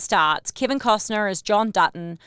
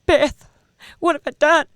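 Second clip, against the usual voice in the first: loud, higher hammed-up anguish; mock crying; 4 semitones higher, 5 dB louder than usual. Imitating the actor crying at the camera, overdone mock sobbing. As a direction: play a badly faked on-camera breakdown. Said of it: "and he does it again".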